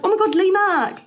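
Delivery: high, loud excited squeal; mock excited. Fan spotting Lee Mack on screen, excited squeal. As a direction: gush over the celebrity.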